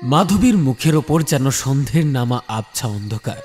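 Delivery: ordinary delivery, nothing marked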